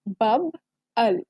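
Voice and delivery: बब अल said slowly as two parts, बब then अल; slow syllable split of बब अल. Shown as a demonstration. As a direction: break बब अल apart slowly, stressing the अल ending. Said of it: "तो इसकी sound हो गई अल".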